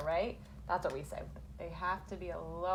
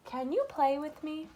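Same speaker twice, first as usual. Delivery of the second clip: higher, asking tone; questioning read; 7 semitones higher, 3 dB louder than usual. Shown as a demonstration. Teacher testing if the sentence asks something, questioning. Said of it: "or maybe is it asking me something".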